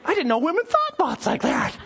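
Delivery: high falsetto shock with a laugh; mock scandalized. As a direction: play the horrified churchgoer, voice way up. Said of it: "And you're going".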